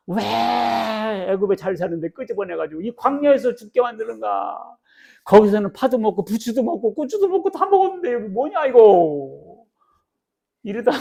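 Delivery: the people grumbling at Moses, loud and whiny; grumbling Israelites. Retold or imitated. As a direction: whine like hungry people in the desert.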